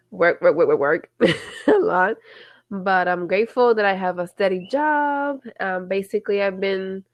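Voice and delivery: normal talking voice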